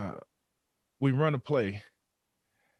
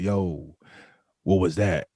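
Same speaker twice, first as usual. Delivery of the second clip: lower, raspy and gruff; 4 semitones lower, 4 dB louder, slower than usual. Retold or imitated. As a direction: rasp out a stern question. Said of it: "he got this real raspy voice".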